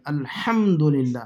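"الحمدللہ" slow with the h from the throat; h clearly sounded. Shown as a demonstration. Teacher stressing the needed letter, throaty h in "الحمدللہ". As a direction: bring out a strong pharyngeal h in "الحمدللہ". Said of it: "ہا کی آواز نکالنا ضروری ہے".

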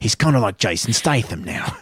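Jason Statham-style East London voice; East London accent. Acted. Podcaster explaining his Hunter voice, East London accent. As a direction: put on a London hard-man accent. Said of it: "He's from East London now".

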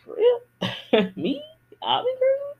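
hammed-up amazed squeal; disbelieving surprise. Answering fans who call her encouraging, high squeal. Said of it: "And I'm like".

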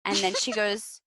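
usual speaking voice

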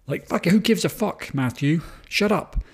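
high, exasperated mock yell at the worm guy. Podcaster mock-yelling at Matthew Broderick's character, high and exasperated. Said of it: "he's the worm guy".

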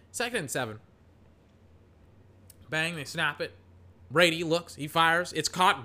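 sportscaster call that rises to a big shout on the catch; hyped live football commentary; play-by-play call. Acted. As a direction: call the play like a TV announcer, peaking on the name. Mock play-by-play of the game.

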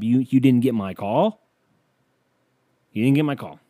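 loud accusing shout upward; yelling up. Retold or imitated. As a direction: yell it like an unanswered call. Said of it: "I've yelled up".

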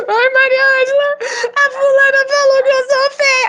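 put-on high, teary little girl's complaint; crying child. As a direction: whimper it like a hurt child. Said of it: "E a menina vinha chorando".

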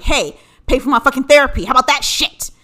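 loud, mock-indignant demand. Daughter messaging parents to pay for therapy, mock-indignant. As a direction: demand it loudly, half in jest.

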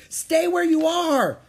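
high, slow, loud warning; shouting. A narrator's reading. Jack to Annie climbing down, shouted warning. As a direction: yell a stretched-out order. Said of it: "Jack shouted".

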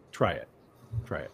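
dry, low retort; deadpan it, low and soft; quiet, low. Retold or imitated. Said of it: "the writers had the best response".

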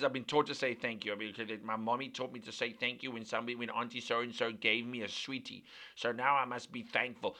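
low, soft, rote recital; mock someone thanking only out of manners; dutiful mannerly thanks. Imitating someone taught by mommy to say thanks, dutifully. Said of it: "just say thank you 'cause good manners".

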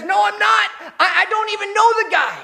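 high, loud, desperate denial; panicked denial. Retold or imitated. Peter denying Jesus to the crowd, a high panicked shout. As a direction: blurt it out in fear of being caught.

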